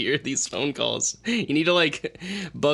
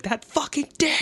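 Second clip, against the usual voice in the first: a much higher mock outraged customer; angry customer voice; 12 semitones higher than usual. Friend imitates customers hearing the podcast, high mock outrage. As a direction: squeal it like an offended caller. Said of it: "they're gonna be like".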